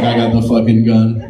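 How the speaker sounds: low, loud gruff boast; laughing gun owner. Retold or imitated. Comic imitating an audience member laughing too hard, low loud boast. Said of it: "He's like".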